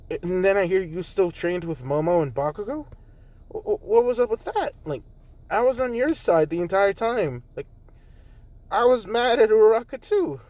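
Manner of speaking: a jilted friend pouring out her grievance; upset Jiro. Acted. Jiro confronting Izuku, upset.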